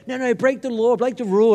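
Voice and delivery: lightly voiced teasing wife. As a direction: urge him to break the rule, playfully. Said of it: "Chloe would always try and go".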